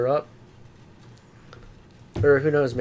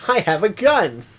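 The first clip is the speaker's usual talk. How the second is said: high mock voice; 10 semitones higher, 5 dB louder, slower than usual.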